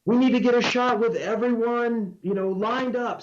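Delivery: high-pitched call out to a crowd; imitating own crowd-wide shout; retold yell. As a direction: project it high, rallying everyone to gather. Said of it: "I just yelled out".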